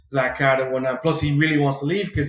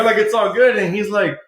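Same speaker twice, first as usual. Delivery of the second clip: normal talking voice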